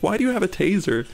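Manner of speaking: a hammed-up demand from the accuser; mock angry accusation. Joker guy repeating his suspicion at the stranger, mock angry accusation.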